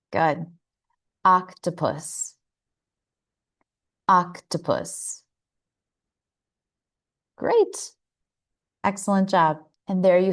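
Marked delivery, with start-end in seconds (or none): 1.2-5.2 "Octopus" with a relaxed, open first vowel, slow; O sound. Shown as a demonstration. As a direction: say "Octopus" slowly with an open ah at the start. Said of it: "now for the O sound".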